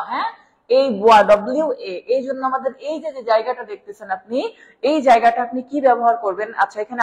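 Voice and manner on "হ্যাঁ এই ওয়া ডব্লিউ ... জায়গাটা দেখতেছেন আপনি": normal talking voice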